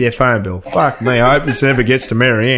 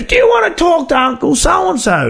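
put-on old auntie voice. Retelling Auntie Joyce answering the prank call, high shrill-free lady voice. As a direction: imitate the auntie squawking down the phone.

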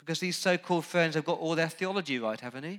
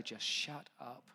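muttered retelling; 2 semitones lower, 11 dB quieter than usual. Retold or imitated. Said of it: "I wanted to say".

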